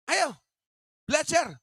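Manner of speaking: high, loud barked command; commanding order. Retold or imitated. Father ordering his child to study, loud command.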